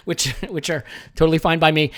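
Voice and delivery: ordinary talk, nothing marked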